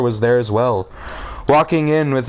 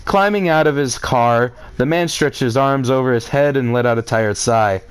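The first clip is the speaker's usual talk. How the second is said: ordinary delivery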